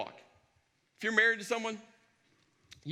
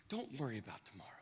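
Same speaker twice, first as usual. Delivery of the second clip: soft quote; 12 semitones lower, 15 dB quieter, faster than usual. Shown as a demonstration. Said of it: "it's why Jesus said".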